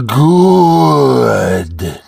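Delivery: loud, drawn-out monster voice; a satisfied beastly rumble; creature growl. A narrator's reading. Demon approving its flesh in a growl. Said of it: "it growled".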